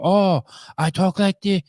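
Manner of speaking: high, slow put-on Chinese accent; lightly imitating a Chinese accent. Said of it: "she had a Chinese accent".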